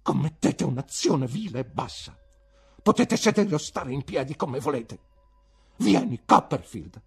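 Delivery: offended teacher's heated verdict; indignant condemnation. Acted. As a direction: denounce the pupil, then dismiss him. Teacher condemns pupil's conduct.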